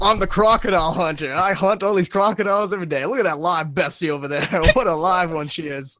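put-on crocodile hunter's Australian accent. Gamer as crocodile hunter spotting a live one, Australian accent. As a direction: play an Australian crocodile hunter showing off a catch. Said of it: "do a fucking Australian accent".

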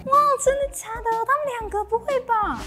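high, excited gossip chatter; gossipy girl voice. Acted. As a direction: squeal like a gossiping friend. Gossip skit opening, high far voice.

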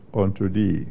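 ordinary talk

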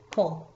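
COLE said as a single short beat; teacher counting one beat on COLE; one syllable. Shown as a demonstration. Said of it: "one syllable".

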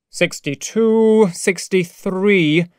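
slow, drawn-out head count; counting aloud. Acted. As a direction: count off slowly, stretching each number. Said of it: "counted Moreau".

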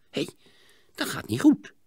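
grumbled. A narrator's reading. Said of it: "bromde Sietse".

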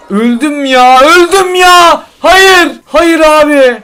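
high, loud wail at dying in the game; anguished cry. Real, not acted. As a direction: cry out in real despair, drawing it out. Dies in the game, despairs. (oyunda ölünce yüksek sesle çaresiz feryat)

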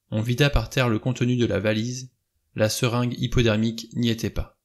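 ordinary delivery

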